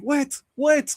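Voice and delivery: very high yell of wet, wet; panicked high cry while running naked; shouting. Retold or imitated. Naked rookie left on the road, high panicked shout.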